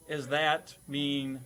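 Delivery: ordinary delivery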